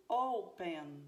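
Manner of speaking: O-pen, with the O punched loud; stress on first syllable. Shown as a demonstration. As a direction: hit the start of "Open", let the end drop. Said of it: "a sílaba tônica não está na última". ("Open" com o O inicial forte)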